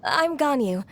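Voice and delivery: flustered stammer. Acted. Shy Ganyu meets Shenhe.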